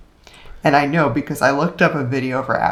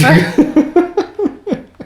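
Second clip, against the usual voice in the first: loud laugh; 6 dB louder than usual. Real, not acted. Laughs after mock accent.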